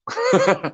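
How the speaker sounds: high trailing laugh; real high-pitched laughter; amused laugh. Real, not acted. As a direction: laugh at the funny bit. Amused by funny story.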